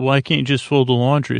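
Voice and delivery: ordinary talk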